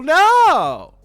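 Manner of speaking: ordinary talk, nothing marked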